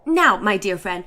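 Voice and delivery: usual speaking voice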